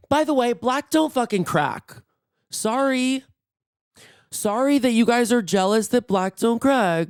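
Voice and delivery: high-pitched playful taunt; mock sassy boast. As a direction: brag at the haters with a high, fake apology. Mock taunt at jealous viewers.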